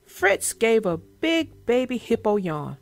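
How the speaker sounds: put-on gentle storyteller reading to kids; story narration. A narrator's reading. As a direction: read it like a bedtime picture book. Reads children's story aloud.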